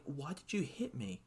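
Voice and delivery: soft, wounded little question; betrayed child. Retold or imitated. Slapped wrestler to his trainer, soft and betrayed. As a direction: ask it like a kid hit by a parent. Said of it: "got this look in his face like".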